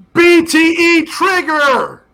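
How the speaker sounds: drawn-out, high-pitched mock yell; imitated catchphrase yell. Co-host mocking wrestlers' yelled catchphrase, drawn-out high yell. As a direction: bellow it high and long, mimicking the catchphrase. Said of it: "as long as they stop yelling".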